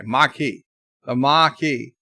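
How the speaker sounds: Maquis as "mah-key", no R. Shown as a demonstration. Host practises the correction, Maquis as "mah-key". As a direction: sound Maquis just as spelt, "mah-key". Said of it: "M A H K E Y".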